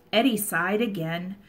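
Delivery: ordinary delivery, nothing marked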